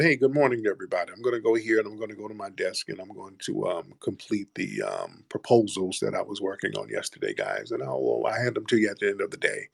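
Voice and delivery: soft, low-key office greeting; quiet, businesslike worker. Acted. Quiet brother greeting the office before going to his desk, softly businesslike. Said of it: "a brother who's just kinda quiet".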